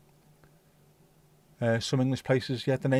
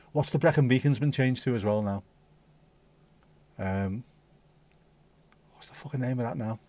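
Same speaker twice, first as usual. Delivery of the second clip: ordinary delivery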